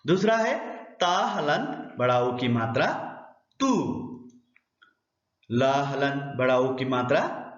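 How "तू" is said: तू with a high, drawn-out oo; long vowel. Shown as a demonstration. Teacher sounds out the second syllable example, long vowel. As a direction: stretch the vowel of तू, pitched up. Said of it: "उच्चारण थोड़ा सा लंबा होता है".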